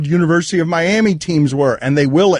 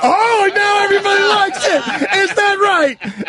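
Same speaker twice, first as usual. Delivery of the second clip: high-pitched outburst. Real, not acted. Host reacts to everybody liking it now, high-pitched shout. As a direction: shout it way up high, stretching the words. Outburst after the clip, laughing.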